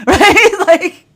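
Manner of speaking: high, loud burst through real laughter; laughing. Real, not acted. Reader amused by the voiced feminine's complaint, laughing. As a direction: crack up while speaking, pitch leaping up. Laughing at her own acted quote.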